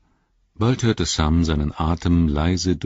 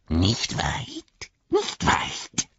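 Gollum voice; much slower than usual. Acted. Gollum teasing Sam from above the gully, hissing. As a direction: creep the words out like a lurking creature.

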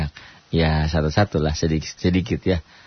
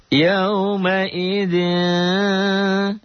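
slow, high chanted tilawah; Quran recitation; 11 semitones higher, much slower than usual. Shown as a demonstration. Teacher reciting the verse for the class, slow chant. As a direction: draw the words out melodically.

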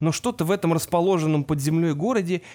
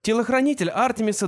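ordinary delivery, nothing marked

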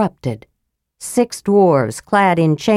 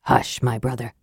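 softer, hushing his brother gently; calm him with a soft hush; 7 semitones lower, 7 dB quieter than usual. A narrator's reading. Raistlin quieting his twin in the dark, softly. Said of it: "said Raistlin softly".

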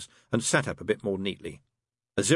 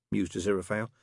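usual speaking voice

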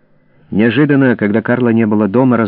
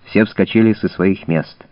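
plain reading voice

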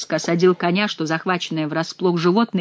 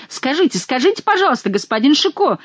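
breathless cry; 7 semitones higher than usual. A narrator's reading. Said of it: "закричал он".

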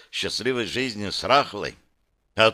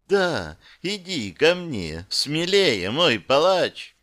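high, slow, otherworldly lure; seductive goddess; 5 semitones higher, slower than usual. A narrator's reading. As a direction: beckon him in, savouring it. Goddess luring him closer.

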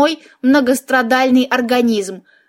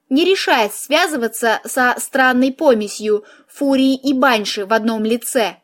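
ordinary delivery

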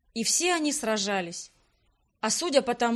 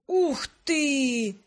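impressed whistle; 3 semitones higher, 3 dB louder than usual. A narrator's reading. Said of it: "присвистнул Грегориан".

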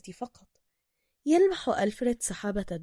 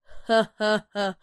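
amused laugh; 5 dB louder than usual. Acted. Officer laughs at Bruce.

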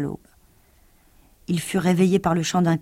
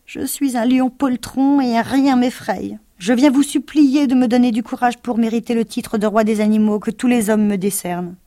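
pleading cowardly lion; 5 semitones higher, 4 dB louder than usual. Acted. Lion begging Oz for courage, high pleading. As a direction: beg for courage, trembling and loud.

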